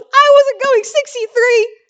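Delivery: high, loud, defiant denial; indignant outburst. Retold or imitated. Telling how she snapped at the officer, indignant. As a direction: blurt it out, outraged at the cop. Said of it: "And I was so mad".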